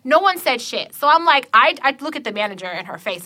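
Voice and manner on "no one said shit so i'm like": ordinary delivery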